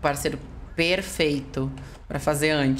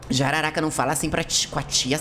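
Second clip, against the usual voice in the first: ordinary talk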